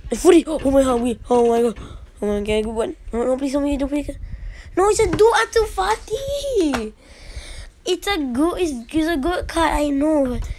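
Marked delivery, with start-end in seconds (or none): 4.8-9.7 whiny complaining. Real, not acted. Disappointed with pulled card.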